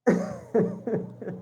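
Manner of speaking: a quiet unvoiced chuckle; amused, stifled laugh; breathy stifled laugh. Real, not acted. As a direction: laugh under your breath in disbelief. Laughing at the escort profile.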